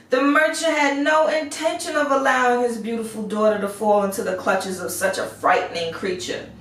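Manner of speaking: plain reading voice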